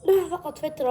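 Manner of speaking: normal talking voice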